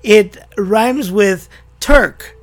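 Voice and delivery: slow, loud quoted line; slow reading aloud. Retold or imitated. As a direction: read it out slowly and loudly. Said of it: "he writes to me and he says".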